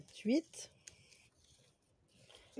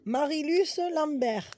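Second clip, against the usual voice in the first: slow announcing; 4 semitones higher, slower than usual. Real, not acted. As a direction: draw each syllable out like a winner's name. Announcing a giveaway winner.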